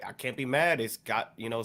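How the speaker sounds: ordinary talk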